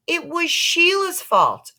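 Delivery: put-on boy blaming Sheila; protesting boy. A narrator's reading. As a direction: whine in protest. Peter protests to mother.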